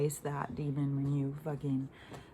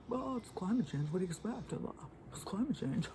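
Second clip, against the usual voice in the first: higher, sing-song mock of the official line; 5 semitones higher, 4 dB quieter than usual. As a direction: parrot them with a sneer. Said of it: "They told us it's climate change".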